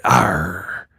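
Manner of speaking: low, quiet pirate drawl. Retold or imitated. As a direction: talk like a hammy pirate. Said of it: "She talk like a pirate".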